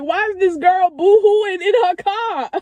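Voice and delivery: lightly mocking onlooker, pitched high; puzzled bystander imitated through tears; imagined onlooker. Passing driver wondering about the crying girl, mock-puzzled. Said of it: "She probably like".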